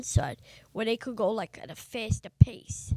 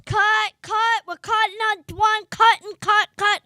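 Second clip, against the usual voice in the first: high loud repeated cut calls; producer yelling cut; 9 semitones higher, 6 dB louder than usual. Retold or imitated. Producer stops filming, shouting cut. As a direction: shout cut to stop the take. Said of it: "The producer goes".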